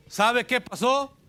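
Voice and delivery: high, loud and slow dramatic build-up; preacher's loud, drawn-out teaser; dramatic question. Real, not acted. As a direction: hold them in suspense.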